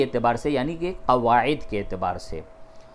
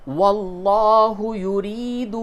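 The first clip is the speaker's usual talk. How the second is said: slow, high chanted tajweed recitation; Quran recitation; 7 semitones higher, 5 dB louder, much slower than usual. Shown as a demonstration. Teacher chanting the verse for students, slow and melodic. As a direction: intone the verse slowly and melodically.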